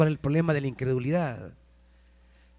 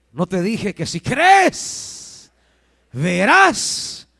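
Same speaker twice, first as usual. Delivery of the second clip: raised voice, key words drawn out high and loud; respuesta enfática; 5 semitones higher, 7 dB louder, much slower than usual. Acted. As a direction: stretch and lift CREES and VERÁS. Voices Jesus's emphatic reply. (respuesta enfática, alargando las palabras)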